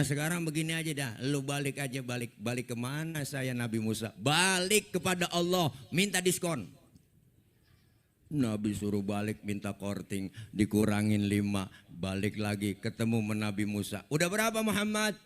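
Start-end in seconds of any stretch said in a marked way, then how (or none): 4.2-6.7 urging advice. Acted. Musa menyuruh balik. 14.1-15.1 eager question. Acted. Musa bertanya.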